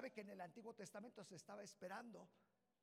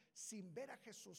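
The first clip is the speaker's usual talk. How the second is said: usual speaking voice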